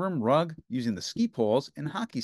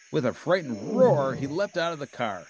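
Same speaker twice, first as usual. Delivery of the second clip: plain reading voice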